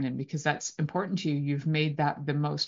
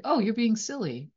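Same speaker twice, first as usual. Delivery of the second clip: light, higher teasing lilt; playful teasing; 6 semitones higher than usual. Shown as a demonstration. Partner brushing off with playful intonation, lightly teasing. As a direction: tease it gently, not a put-down. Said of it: "they hear the intonation differently".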